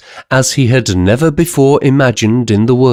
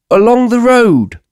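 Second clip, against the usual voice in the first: inspector; 12 semitones higher than usual. Acted. Inspector answering.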